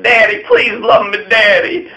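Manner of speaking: put-on a leader begging Napoleon like a needy child, in a high pleading voice; mock begging child. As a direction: whine up high for daddy's love. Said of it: "He was begging Napoleon".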